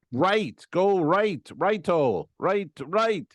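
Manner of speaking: high, insistent repeated command; exasperated passenger giving directions; urgent directing, retold. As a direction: re-enact frantically steering a driver. Directing driver who misses the turn.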